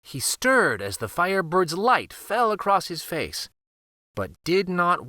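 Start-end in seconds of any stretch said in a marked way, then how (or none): none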